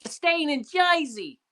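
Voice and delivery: slow, high mock Jersey drawl on "Staying in Jersey"; playful Jersey accent impression; New Jersey accent. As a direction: imitate the cashier's thick Jersey accent, stretched out. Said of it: "it's the way she talked".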